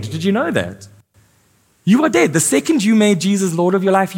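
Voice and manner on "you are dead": much higher, punched DEAD; forceful repeat. Acted. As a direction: drive the word dead home.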